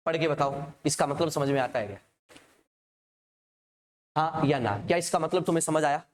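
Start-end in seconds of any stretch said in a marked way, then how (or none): none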